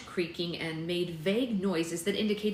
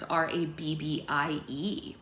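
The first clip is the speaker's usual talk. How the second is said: plain reading voice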